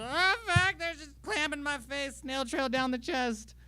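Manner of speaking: high, strained cry of horror; mock anguished wail. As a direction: wail it out, drawing out the end. Acting out the dunked-on player.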